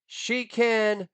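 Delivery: ordinary delivery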